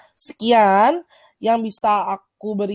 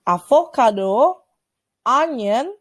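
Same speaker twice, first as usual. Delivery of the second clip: slow vocabulary model; 3 semitones higher, slower than usual. Shown as a demonstration. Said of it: "gimana cara aku ngomong".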